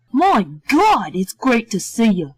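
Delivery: Ace, lightly voiced, relieved hug greeting; strong relief. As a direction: breathe it out like a weight has lifted. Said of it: "his voice expressing strong relief".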